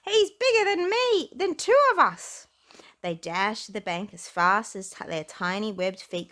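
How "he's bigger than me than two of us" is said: high, loud frightened chatter; panicked little duckling; scared duckling. Acted. Duckling fleeing Percy, high loud frightened cry.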